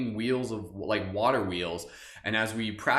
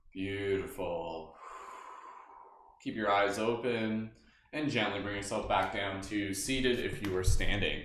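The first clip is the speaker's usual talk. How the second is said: guided meditation; 3 semitones lower, 5 dB quieter than usual. Acted. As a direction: guide them gently inward. Leads a meditation.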